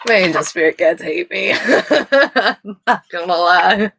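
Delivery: laughing through the words. Real, not acted. Reader laughs while reading her spirit guides' card. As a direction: giggle it out helplessly.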